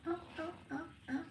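high, soft cooing call to the cat; high coaxing call. Real, not acted. Owner coaxing her cat to play, high soft cooing. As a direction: coo at the cat in a high little voice.

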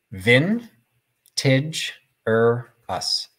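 very slow, syllable by syllable; sounded out phonetically. Shown as a demonstration. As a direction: sound out "Vintage or us" in separate, drawn-out chunks, vin-tidge-er-us. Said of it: "Now I have the phonetic spelling".

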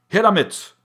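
loud, high demand; gruff command. A narrator's reading. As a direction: bark it eagerly. Miller grabs the sack.

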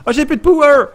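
high, loud startled cry; sudden panicked outburst; alarmed exclamation. Real, not acted. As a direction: gasp at the bad news. Battery running out alarm.

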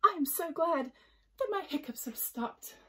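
high-pitched delighted relief; happy Jellyfish. A narrator's reading. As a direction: start loud and high with happiness. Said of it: "Jellyfish wriggled with happiness".

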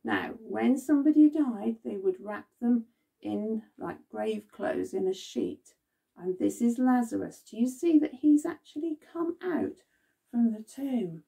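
usual speaking voice

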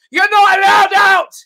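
loud, slow, high barked order; mock shout. Comic shout at latecomers stuck in traffic. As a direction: yell it like a bouncer barring the door.

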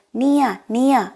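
high, slow questioning in amazement; astonished. A narrator's reading. Sugaveer amazed at who is on the phone, astonished. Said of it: "ஆச்சரியப்பட்டான்".

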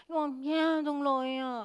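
feeble voice. Shown as a demonstration. Teacher showing the class the weakest voice, feeble and limp. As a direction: let the voice sag as weak as it can. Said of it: "อ่อนก๋อยที่สุดเลยนะคะ".

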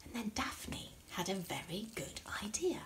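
soft secretive whisper. A narrator's reading. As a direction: whisper the idea. Whispered narration aside.

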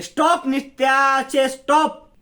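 high, loud, drawn-out scream of stop; furious shout. Acted. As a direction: yell at her to stop, voice cracking high. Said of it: "Shalini जोरात ओरडते".

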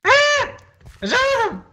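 very high cry for help; panicked scream. Real, not acted. Spider attack scream.

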